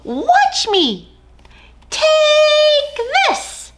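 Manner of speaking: tiny hero yelling; mouse battle shout. Acted. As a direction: charge the grass blade bravely. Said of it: "and shouting".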